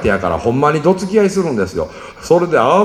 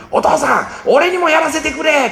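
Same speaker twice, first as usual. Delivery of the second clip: put-on high, eager child's plea; begging kid; 12 semitones higher than usual. Son begs father to let him fish. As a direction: plead like a boy who can't wait.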